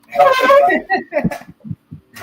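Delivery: big high burst of laughter; loud laugh. Real, not acted. Laughing about underdog.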